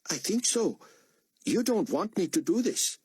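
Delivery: put-on high, nervous boy voice; young boy. A narrator's reading. Boy answering Buck's questions, nervous. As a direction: answer hesitantly. Boy's voice.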